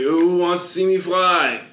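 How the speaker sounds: flustered, high, loud showman; overbright kids party host. Acted. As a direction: stumble in then sell the magic. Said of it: "he's gonna be like".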